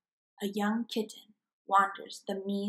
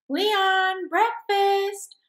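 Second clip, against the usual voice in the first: higher, louder call across the house; 11 semitones higher, 8 dB louder, much slower than usual. Acted. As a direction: mom shouting that breakfast is ready. Mom calling kid to breakfast.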